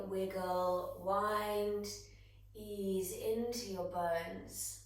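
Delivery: ordinary talk, nothing marked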